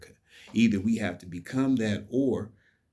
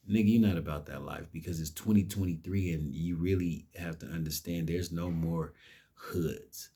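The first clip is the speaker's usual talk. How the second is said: ordinary talk, nothing marked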